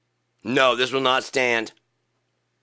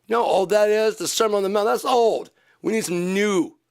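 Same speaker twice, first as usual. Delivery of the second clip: mocking complainer voice; 9 semitones higher than usual.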